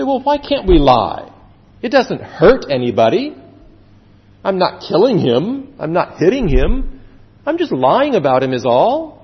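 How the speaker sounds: acted indignant excuse-making; mock protesting. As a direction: argue back as an objector excusing himself. Acting out objectors defending lying.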